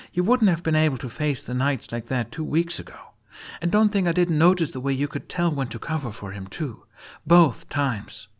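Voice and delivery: Astrid. Acted. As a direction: push hard, won't let him dodge.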